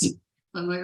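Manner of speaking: ordinary delivery, nothing marked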